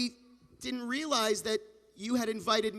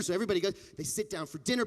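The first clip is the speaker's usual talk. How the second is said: usual speaking voice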